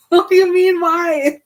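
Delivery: high, loud comic disbelief; incredulous mock yell. Bella answering Edward's "But why?", hammed-up indignant yell. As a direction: throw the question back, loudly indignant.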